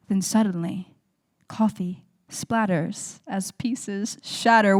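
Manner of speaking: loud, measured spoken-word delivery; poem recitation. Acted. Performer reciting a poem about family dishes, spoken-word style. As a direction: project each phrase to the room, pausing between images.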